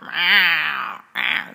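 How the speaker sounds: harsh, drawn-out alley cat yowl; slow, rough alley cat meow. Shown as a demonstration. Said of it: "not an alley cat. It's not".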